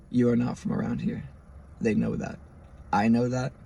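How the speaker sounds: slightly hushed, measured retold warning; quiet warning. Cop warning an out-of-towner, quiet and measured. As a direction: deliver it softly, phrase by phrase.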